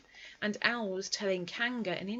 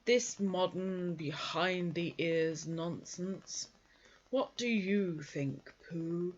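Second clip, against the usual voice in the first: grumbling Eeyore; 2 semitones lower, much slower than usual. Acted. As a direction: drag every word out like a disgruntled old donkey. Eeyore's voice held.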